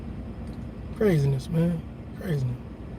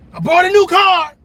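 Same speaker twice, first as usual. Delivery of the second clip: bragging; 10 semitones higher, 7 dB louder than usual. Pretend. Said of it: "to talk shit".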